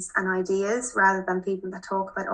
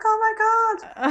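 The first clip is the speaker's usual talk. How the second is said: shocked; much faster than usual. Retold or imitated. Shocked the parents saw party photos.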